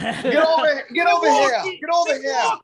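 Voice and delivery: put-on loud New York accent shout. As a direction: yell it like a New Yorker. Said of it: "my New York accent would be fuego".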